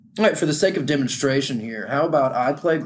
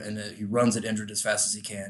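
ordinary talk, nothing marked

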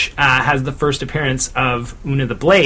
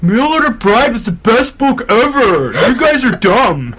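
angry troll listener; 9 semitones higher, 4 dB louder than usual. Pretend. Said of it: "Yelling their opinions".

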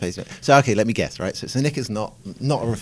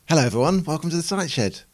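higher, put-on cheery podcast welcome; overdone pitched-up host hello; fake enthusiasm; 9 semitones higher than usual. Shown as a demonstration. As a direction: brighten the greeting until it sounds fake. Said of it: "That sounds fake".

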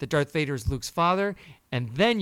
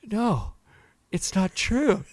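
higher, pleading little denial; imitating shocked child; 6 semitones higher, slower than usual. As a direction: play the son refusing to believe it. Son's shocked denial voiced.

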